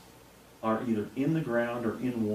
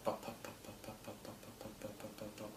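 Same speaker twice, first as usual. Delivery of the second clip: soft popping engine noise, much quieter; imitated engine putter; 17 dB quieter than usual. Describing the hovering spacecraft's engine, soft putt-putt. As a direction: putter like an idling little engine. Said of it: "noise of a combustion type engine".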